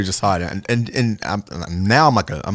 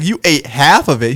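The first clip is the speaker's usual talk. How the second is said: raised, louder incredulous question; mock disbelief; 6 semitones higher, 6 dB louder than usual. Friend learns his shroom pizza was eaten, mock disbelief. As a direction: imitate a shocked friend, pitched up.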